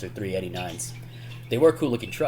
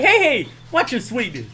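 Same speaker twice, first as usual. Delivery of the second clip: high, loud comic warning; mock indignant protest; 13 semitones higher, 5 dB louder than usual. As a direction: protest in a high movie-imitation voice. Acted protest about grabbed suit.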